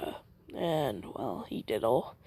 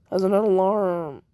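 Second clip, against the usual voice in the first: voice crack; 5 dB louder than usual. Real, not acted. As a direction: voice cracks mid-phrase. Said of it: "you didn't hear that voice crack".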